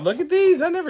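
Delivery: high, loud thrilled squeal; excited squeal. Real, not acted. Unboxing a fan's gift, squealing loud and high.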